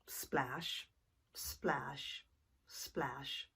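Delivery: soft, slow hushed splash sounds; slow splashes. Acted. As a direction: let each splash drift slowly. Rowed slowly.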